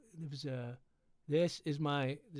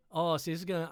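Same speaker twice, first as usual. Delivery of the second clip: plain reading voice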